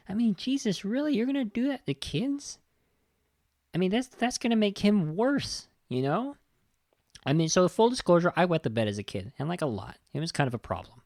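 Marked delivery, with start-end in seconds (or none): 0.1-5.7 outraged disbelief. Real, not acted. Outraged reaction to movie scene.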